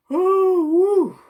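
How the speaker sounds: high panting woo, out of breath; breathless high woo exhale. Real, not acted. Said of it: "I'm seriously out of breath".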